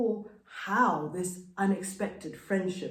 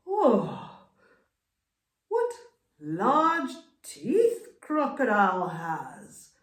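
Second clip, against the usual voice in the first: chameleon's thought; 2 semitones higher, 4 dB louder, slower than usual. Acted. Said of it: "thought the chameleon to himself".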